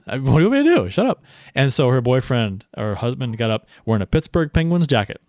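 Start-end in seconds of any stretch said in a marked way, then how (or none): 0.1-1.2 rising, louder exasperated "shut up"; mock telling-off. Telling the loud woman to shut up, exasperated rise. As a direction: fire it back at the annoying fan.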